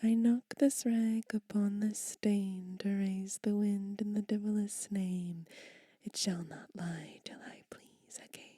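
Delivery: emphatic whispered incantation; spell reading. Acted. Whispered witches' spell read to viewers. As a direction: cast the spell under your breath.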